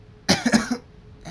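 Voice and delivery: harsh, loud sickly coughing fit. Acted. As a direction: cough like a feverish child. Sick filly coughing.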